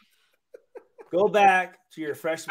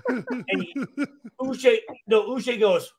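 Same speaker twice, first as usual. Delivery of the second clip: ordinary delivery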